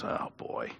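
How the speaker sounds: breathy hushed whisper leaning in; a friend whispering a worried warning across the table; whispered aside. Retold or imitated. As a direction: whisper it so the waiter won't hear. Paul leans over whispering.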